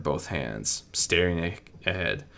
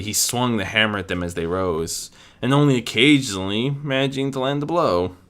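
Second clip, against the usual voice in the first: ordinary delivery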